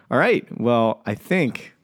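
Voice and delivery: drawn-out announcer wrap; slow it right down, like closing the show; mock-grand outro; slow sign-off. Real, not acted. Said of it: "host winding the show up".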